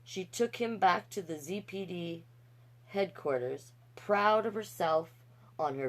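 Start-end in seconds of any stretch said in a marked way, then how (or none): none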